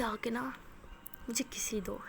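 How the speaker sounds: soft whisper, almost breathed; whispered request. Acted. Aliya coaxing Maya for a kiss, in a soft whisper. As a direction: lean in and whisper it.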